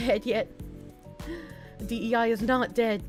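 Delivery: ordinary talk, nothing marked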